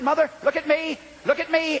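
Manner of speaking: high-pitched, pressing appeal; urgent appeal. Retold or imitated. Preacher to a condemned man's mother, urgent appeal.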